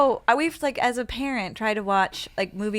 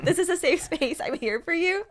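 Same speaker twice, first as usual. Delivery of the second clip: laughing; 8 semitones higher than usual. Real, not acted. Mock support while laughing.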